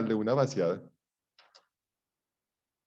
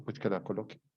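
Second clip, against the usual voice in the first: softer, dismissive; defiant shrug; 3 semitones lower, 8 dB quieter than usual. Retold or imitated. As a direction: shrug off the threat quietly. Respuesta desafiante. (respondiendo al jefe con desdén, bajito)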